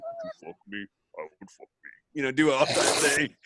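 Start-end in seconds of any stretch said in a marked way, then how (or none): 0.0-1.9 breathy mock seductive purr; sexy voice. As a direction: ham up a sultry come-on. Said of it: "Is that your sexy voice?".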